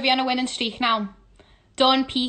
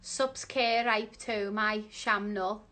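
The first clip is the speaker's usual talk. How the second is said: soft, halting, puzzled reading; slow and unsure, quietly; slow sounding-out; 2 semitones lower, 6 dB quieter, slower than usual. Real, not acted. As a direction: read word by word, working it out.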